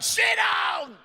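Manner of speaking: very high, loud shout; barked order. Retold or imitated. Midwife to driver trying to leave, high explosive shout. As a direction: yell to stop him leaving.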